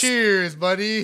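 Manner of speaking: high, loud toast; cheerful toast. Real, not acted. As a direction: raise the glass and shout it. Toasting drinks.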